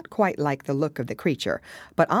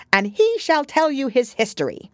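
haughty Queen; 10 semitones higher, 6 dB louder than usual. A narrator's reading. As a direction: demand it like a bossy queen. Queen voiced in story.

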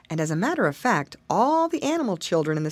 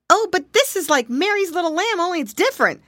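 put-on high, loud squirrel boy; 12 semitones higher, 5 dB louder, faster than usual. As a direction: chime in like a little boy.

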